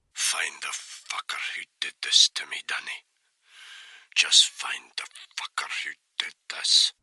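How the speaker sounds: dying man's weak whisper; barely voiced, breath only. A narrator's reading. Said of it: "in a weak voice".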